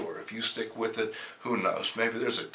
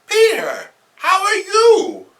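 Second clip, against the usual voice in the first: very high, slow fretting aunt; high worried aunt calling out; worried old aunt; 14 dB louder, slower than usual. Acted. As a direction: fret over your nephew. Aunt third take.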